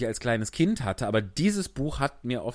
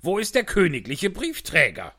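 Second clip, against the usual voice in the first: whiny king; 6 semitones higher, 4 dB louder than usual. Acted. King demands his post.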